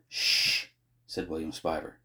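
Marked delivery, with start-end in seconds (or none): 0.1-0.7 long soft shush; hushing. A narrator's reading. Boy soothing his distressed friend, hushing. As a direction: shush them to settle them down.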